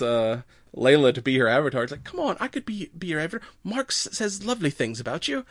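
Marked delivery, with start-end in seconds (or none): 2.0-5.5 put-on higher, soft coaxing Valley Girl; Valley Girl accent. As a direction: sweetly wheedle like a Valley Girl. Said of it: "almost Valley Girl accent".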